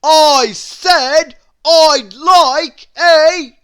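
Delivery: very loud, high, slow yell; top-of-voice shout. A narrator's reading. Mister Noisy yelling at the baker before stopping himself. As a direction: yell it at full force, then cut off. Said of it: "shouted Mister Noisy at the very top of his voice".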